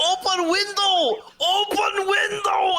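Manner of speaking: hammed-up taxi driver bellowing slowly and high; yelling driver. Taxi driver yells at passengers to open the window, high slow mock shout. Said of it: "the driver is like".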